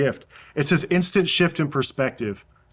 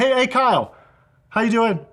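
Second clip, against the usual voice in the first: calling across the room; 8 semitones higher than usual. Shown as a demonstration. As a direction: hail a friend at the back of the hall. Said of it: "He's shouting 'cause he's far away".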